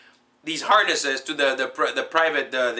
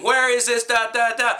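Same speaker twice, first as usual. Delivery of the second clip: raised, high mock-demanding voice; retold interrogation; 10 semitones higher, 4 dB louder than usual. CIA man grilling a captive on the plane, mock shouted demand. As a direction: imitate a loud demand, pitched up high.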